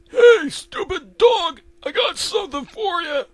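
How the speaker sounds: loud, very high character shout; Eustace taunting. Acted. Eustace about to scare the dog, loud taunt.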